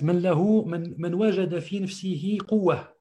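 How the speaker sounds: usual speaking voice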